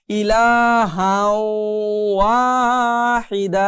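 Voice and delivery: ইলাহাও ওয়াহিদা very slow and drawn; slow Quranic recitation. Shown as a demonstration. Teacher recites the verse slowly for the class. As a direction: chant it out long. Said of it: "মিলিয়ে পড়তে হবে".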